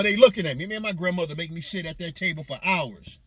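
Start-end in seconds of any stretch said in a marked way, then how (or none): 0.0-0.7 put-on little boy complaining. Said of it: "So I tell my grandmama".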